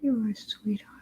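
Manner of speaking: quiet, hushed praise for the dog; soft pet soothing. Real, not acted. Owner hushing her dog to rest, soft murmur. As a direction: murmur him toward sleep.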